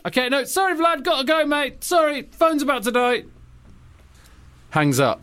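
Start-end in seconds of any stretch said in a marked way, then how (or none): 0.1-3.2 mock flustered Obama. Obama hurriedly hangs up.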